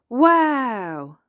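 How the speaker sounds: high, loud wow of wonder; amazed gasp. A narrator's reading. Child seeing the decorations, gasping in amazement. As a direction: let "Wow" burst out in awe. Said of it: "gasped Peppa".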